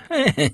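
brief acted chuckle; short chuckle. Narrator voices a character's short laugh. (krótki, odegrany chichot)

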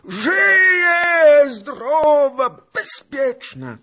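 very high, loud, drawn-out yell; old beggar hollering back; shouted reply. A narrator's reading. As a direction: yell the answer, easing at the end. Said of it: "odkrzyknął dziad".

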